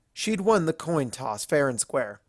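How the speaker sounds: ordinary delivery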